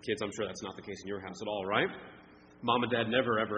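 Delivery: ordinary delivery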